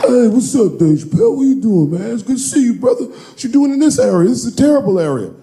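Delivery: high, hearty bodyguard greeting. Retold or imitated. A bodyguard greeting Dave at the door, hearty. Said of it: "one of Kevin's goons opened the door".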